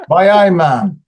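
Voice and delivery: laughing attempt at Geordie; mock Geordie through laughter; Geordie accent. Shown as a demonstration. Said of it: "repeat after me".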